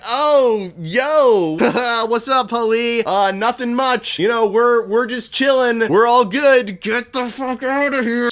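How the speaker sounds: hammed-up innocent nonchalance; fake casual dude voice. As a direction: play it cool like you're hiding something obvious. Matoro faking casual to Hali.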